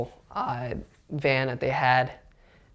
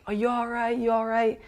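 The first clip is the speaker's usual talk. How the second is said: retold shout, pitch well up; mock yell; 9 semitones higher than usual. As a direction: imitate people screaming without raising the volume. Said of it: "like, screaming, like".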